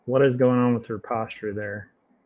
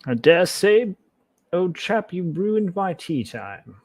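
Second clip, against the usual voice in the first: hammed-up British accent, higher and posh-toned; a jokey bad British accent for the character; awful British accent; 6 semitones higher than usual. Vlogger voicing the offended Victorian, mock British accent. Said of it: "my awful British accent".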